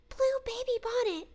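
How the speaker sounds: put-on high, small baby voice; baby voice attempt. Shown as a demonstration. Streamer trying the baby voice for chat, high and small. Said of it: "like, up here".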